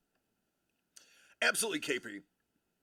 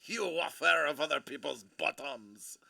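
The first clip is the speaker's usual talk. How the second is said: put-on outrageous French accent. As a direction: overdo the French accent for laughs. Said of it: "this outrageous accent".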